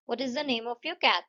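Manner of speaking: What is the name of your cat? falling on cat; slow wh-question settling down on cat; falling tune. Shown as a demonstration. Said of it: "falling हो गई".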